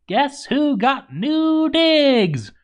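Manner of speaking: drawn-out high singsong tease; smug gloating. Acted. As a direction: gloat slowly, stretching it high. Gloating about his new castle.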